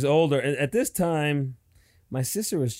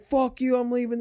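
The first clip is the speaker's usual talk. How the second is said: higher, dismissive shout-back; mock angry retort; 10 semitones higher than usual. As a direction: toss it back as he drives off. Acted quarrel, boyfriend driving off.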